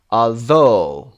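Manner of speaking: although with THOUGH big and falling; stress on second syllable. Shown as a demonstration. Teacher working through the exercise, second-syllable stress on although. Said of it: "second syllable".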